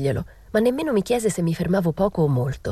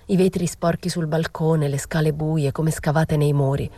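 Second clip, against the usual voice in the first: plain reading voice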